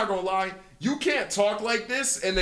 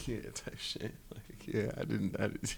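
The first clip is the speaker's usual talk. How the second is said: whispering; 6 semitones lower, 16 dB quieter than usual. Real, not acted. Quiet aside after long pause.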